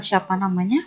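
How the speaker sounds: ordinary delivery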